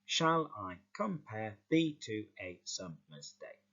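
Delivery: slow beat with slight emphasis on every second syllable, as in "com-PARE"; iambic stress. Shown as a demonstration. Teacher demonstrating iambic pentameter, slow unstressed-stressed rhythm on "Shall I compare". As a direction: lean lightly on "I", "pare" in "compare", "to", "sum" in "summer's" and "day". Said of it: "there's a slight emphasis on that second syllable".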